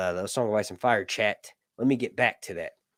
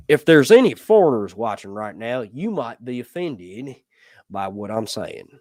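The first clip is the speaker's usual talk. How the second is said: Jeff Foxworthy; 6 dB louder than usual. Acted. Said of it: "do that like Jeff Foxworthy".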